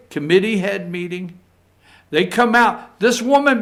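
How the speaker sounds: ordinary delivery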